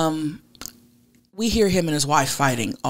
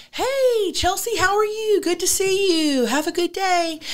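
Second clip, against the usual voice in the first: very high, singsong cheery greeting; hammed-up high, sunny hello; mock friendly neighbor; 14 semitones higher than usual. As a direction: imitate an over-friendly neighbor, pitched way up. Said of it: "he'll be like".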